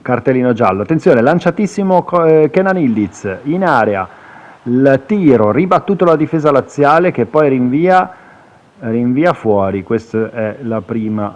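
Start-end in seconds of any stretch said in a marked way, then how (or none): 0.8-9.8 quick excited play-by-play; breathless italian goal commentary. Acted. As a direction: call the attack as it happens. Live chance commentary.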